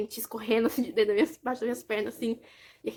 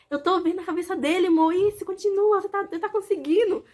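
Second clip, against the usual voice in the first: excited cheering; 7 semitones higher, 4 dB louder than usual. Retold or imitated. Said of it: "o Vanderlei falava assim".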